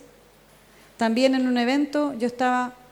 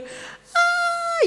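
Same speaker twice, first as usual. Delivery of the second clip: sudden high wail of pain; anguished cry. Retold or imitated. Preacher reliving the vision, letting out a high anguished wail. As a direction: cry out as if something inside is dying.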